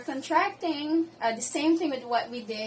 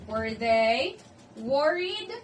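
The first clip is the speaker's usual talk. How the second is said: pause, then push "worried" up in pitch; emphatic model question lifting "worried"; rising question intonation; 2 semitones higher, 6 dB louder, slower than usual. Shown as a demonstration. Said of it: "the intonation will rise at the end".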